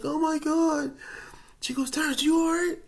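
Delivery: high loud worried cry; alarmed mother. Retold or imitated. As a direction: mom panicking over her son. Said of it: "And she's like".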